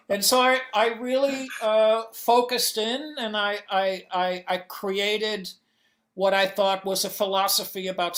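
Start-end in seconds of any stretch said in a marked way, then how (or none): none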